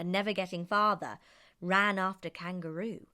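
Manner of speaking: plain reading voice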